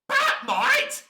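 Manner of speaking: high, loud shocked squeak; squeaky disbelief. Acted. Offered a three-foot role, a squeaky disbelieving shriek. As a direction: squeak it in outrage.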